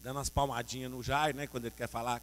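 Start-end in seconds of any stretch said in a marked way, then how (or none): none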